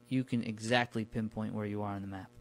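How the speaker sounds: normal talking voice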